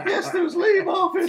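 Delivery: the granny listing names while cracking up; old lady laughing. Retold or imitated. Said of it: "she said".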